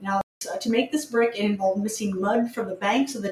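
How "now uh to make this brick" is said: normal talking voice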